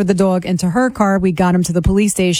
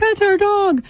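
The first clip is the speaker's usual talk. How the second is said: relieved owners; 11 semitones higher than usual. Retold or imitated. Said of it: "they're like".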